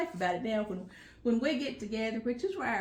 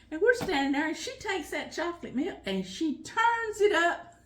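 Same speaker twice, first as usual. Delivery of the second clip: normal talking voice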